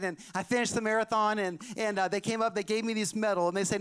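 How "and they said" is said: ordinary talk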